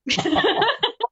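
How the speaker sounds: bright high-pitched giggle. Real, not acted. Laughing at a compliment.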